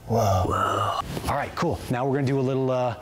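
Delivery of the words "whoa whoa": deadpan surprised whoa; Keanu Reeves impression. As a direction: lower stunned whoa. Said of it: "Give me a little whoa".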